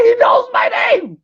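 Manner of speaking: high, slow, excited cry of disbelief; star-struck shout. Retold or imitated. Comic realising the rapper knows his name, excited shout. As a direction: squeal it like a starstruck fan.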